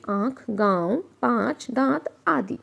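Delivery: आँख, गाँव, पाँच with the vowel through the nose; slowly nasalize आँख and गाँव; nasal vowel demo. Shown as a demonstration. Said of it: "नाक और गले दोनों से बोला जाता है".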